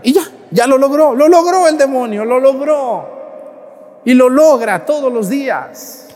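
high, loud preaching cry; loud exclamation. Real, not acted. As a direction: proclaim the devil's victory. Preacher exclaims devil won. (exclamación fuerte y aguda del predicador)

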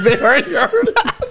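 mock exasperated quote. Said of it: "I'm like".

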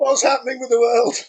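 high, loud theatrical lament; witty period banter. Acted. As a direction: wail it like a melodramatic old gent. Mock despair continues.

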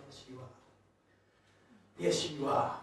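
very soft, low, tender affirmation; gentle reassurance. Retold or imitated. Preacher comforting crying man, hushed gentle reassurance.

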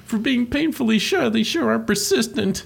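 high, whiny pretend weeping; mock weeping. Retelling Luigi weeping at the ghosts, mock weeping. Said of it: "like, weeping".

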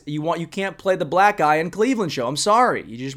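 ordinary delivery

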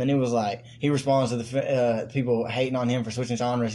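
usual speaking voice

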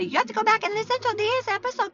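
high, quick mock-nagging voice. Host imitating the reminder she doesn't wanna give, high mock nagging. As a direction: squeak out a fussy instruction.

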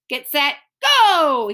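loud, drawn-out starter's shout; starting call. Acted. Scooter starts the calling contest, loud starting call. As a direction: stretch it out like a race starter.

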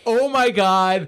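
slow, stretched-out oh my God; drawn-out disbelief. Retold or imitated. Reacting to the creepy kidnapper, slowly stretched in disbelief. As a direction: draw the words out in disbelief. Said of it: "And I was like".

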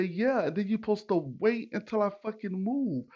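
high-pitched indignant comeback; retold cursing out. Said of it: "I was literally cursing this dude off".

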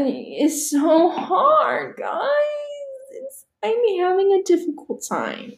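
hammed-up sob over French; mock crying. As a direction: playfully wail about the struggle. Whining about learning French.

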